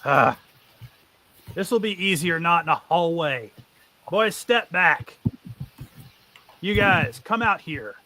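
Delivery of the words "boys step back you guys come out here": higher, slow commands to his men; a bandit boss ordering his men back; bandit leader ordering. Acted. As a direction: take charge of the room. NPC ordering men and party.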